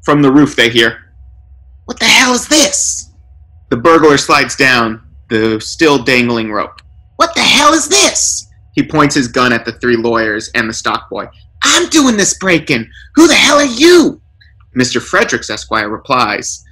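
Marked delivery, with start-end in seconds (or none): 1.8-3.1 much higher, startled gruff thug; high burglar outburst. A narrator's reading. Burglar on the roof finds lawyers inside, higher and startled. 7.2-14.2 rising outrage at the intruders; high angry burglar. A narrator's reading. Burglar points his gun at the lawyers, much higher and indignant.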